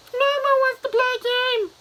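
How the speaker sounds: very high, loud falsetto puppet voice. Retold or imitated. Puppet on TV addresses trapped man, falsetto puppet voice. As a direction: squeal it like a children's show puppet. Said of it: "this puppet's on T V and he goes".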